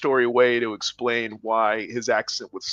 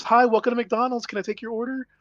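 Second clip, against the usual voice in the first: higher, chipper cashier voice; drive-through greeting; 11 semitones higher than usual. Retold or imitated. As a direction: rattle it off like a fast-food worker. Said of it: "Sounded like regular ass English".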